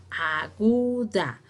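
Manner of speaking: "aguda" drawn out as a, gu, da; syllable by syllable. Shown as a demonstration. Teacher naming the rule's key term, "aguda" syllable by syllable. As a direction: slowly separate the syllables of "aguda".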